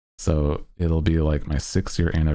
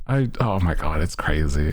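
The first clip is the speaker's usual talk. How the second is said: usual speaking voice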